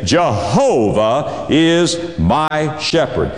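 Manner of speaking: "Jehovah is my shepherd" slow, each word set apart; slow, deliberate declaration of "Jehovah is my shepherd"; weighty proclamation. Shown as a demonstration. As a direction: stretch "Jehovah", then space out "is", "my", "shepherd". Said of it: "And you can say".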